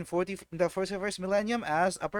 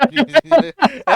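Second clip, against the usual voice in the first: loud laugh; 10 semitones higher, 15 dB louder than usual. Real, not acted. Laughing at own joke.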